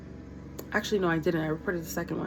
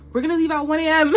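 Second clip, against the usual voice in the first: exasperated; 7 semitones higher, 7 dB louder, faster than usual. Retold or imitated. Exasperated quote to coworker.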